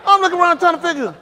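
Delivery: high, loud, laughing amazement; laughing hard. Real, not acted. Preacher teasing Lee over a smooth trick, laughing. Cracks up at someone's slick trick.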